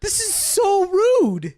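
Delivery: high, loud, stretched-out outrage; comic scandalized cry; appalled exclamation. Acted. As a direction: draw every word out in mock horror. Exclaiming shock at the rudeness.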